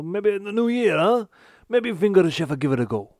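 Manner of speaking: high put-on Italian accent. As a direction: play a hammy Italian chef accent. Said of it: "you now sound Italian".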